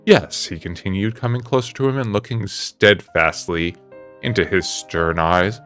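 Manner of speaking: plain reading voice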